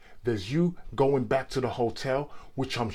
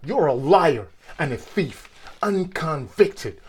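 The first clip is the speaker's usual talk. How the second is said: louder, higher, heated attack; angry accusation; 5 semitones higher, 7 dB louder than usual. Acted. Heated accusation between adversaries.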